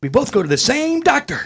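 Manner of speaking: loud, high-pitched exclamation; hammed-up big reveal; punchline delivery. Punchline acted after jumping up.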